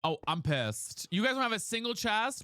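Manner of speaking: normal talking voice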